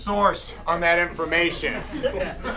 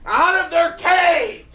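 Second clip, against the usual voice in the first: hype shout; 10 semitones higher, 5 dB louder than usual. Acted. MC hyping caged fighters.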